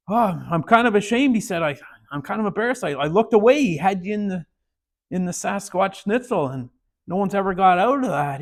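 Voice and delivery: stunned coach. Retold or imitated. Coach stunned after the win.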